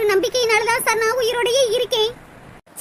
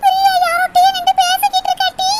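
excited calling out; 7 semitones higher, 5 dB louder than usual. Acted.

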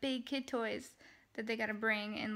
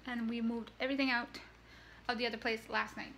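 usual speaking voice

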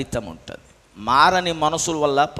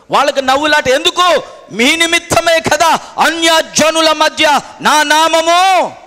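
loud, high sermon delivery, stretched at the peaks; fiery preaching; 8 semitones higher, 8 dB louder than usual. Real, not acted. Preacher denouncing servants who shame God's name, fiery preaching. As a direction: denounce it at full force from the pulpit.

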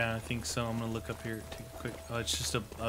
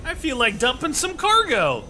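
goofy exclamation; 13 semitones higher, 6 dB louder than usual. Pretend.

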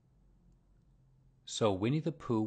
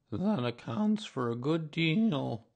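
slow, higher doleful donkey voice; gloomy; 6 semitones higher, 4 dB louder, slower than usual. A narrator's reading. Donkey reflecting on his lost tail, gloomily. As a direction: draw it out mournfully. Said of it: "said Eeyore gloomily".

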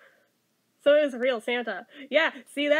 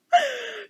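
sharp shocked gasp; 9 semitones higher than usual. Real, not acted. Outraged at mother bear.

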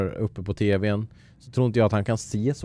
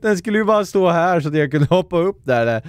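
high, loud, straight out of a laugh; loud amused outburst; 6 semitones higher, 6 dB louder than usual. Real, not acted. Gamer laughs at his own mistake, loud amused outburst. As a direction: blurt it out loudly after laughing.

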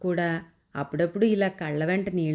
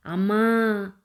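higher, louder sudden cry; startled shout for help; cry of alarm; 4 semitones higher, 4 dB louder, much slower than usual. Acted. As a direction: call out as she falls. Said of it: "అనే కేక వినపడి".

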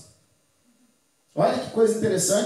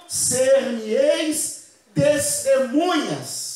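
slow solemn quote; much slower than usual. Acted. Quoting Acts, slowed and solemn.

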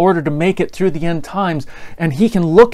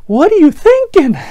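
much higher, louder, disbelieving cry; incredulous; 12 semitones higher, 6 dB louder than usual. Retold or imitated. The little brother watches his sister's spanking, high incredulous cry. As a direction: react to a sister who won't give in. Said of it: "And I'm sitting there going".

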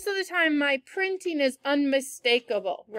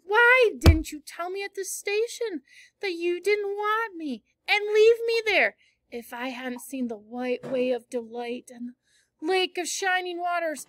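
reproachful Anne; 4 semitones higher than usual. Acted. Anne reproaching Matthew at the station, hurt and higher. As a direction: scold him for leaving her. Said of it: "she added reproachfully".

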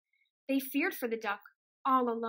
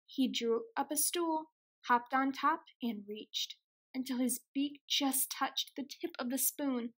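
usual speaking voice

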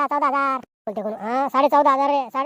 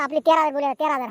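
normal talking voice

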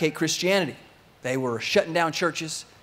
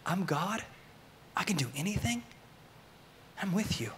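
soft, higher-pitched near-whisper; intimate, quiet comfort; hushed reassurance; 4 semitones higher, 9 dB quieter than usual. Retold or imitated. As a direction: voice God's promise in a hush, leaving space between phrases. Voicing God's comforting promise.

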